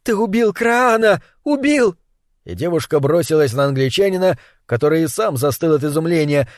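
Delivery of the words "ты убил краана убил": high, shrill accusing scream; shrill screeching bird woman. Acted. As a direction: shriek it at him, high and shrill. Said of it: "пронзительно завизжала".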